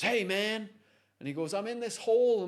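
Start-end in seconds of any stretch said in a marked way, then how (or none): 0.0-0.7 loud, high hype-man hail; motivational speaker. Retold or imitated. Motivational speaker hails the man in the hole, hyped-up call. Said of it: "the motivational speaker goes". 1.5-2.5 high, loud mock call from below. Trapped man answers the speaker above, calling up.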